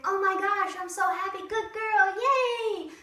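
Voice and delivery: high, bouncy excited praise to the dog; squealing happy dog praise. Shown as a demonstration. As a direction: gush over a good girl. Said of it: "I'm gonna be like".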